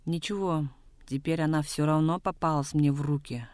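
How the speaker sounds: usual speaking voice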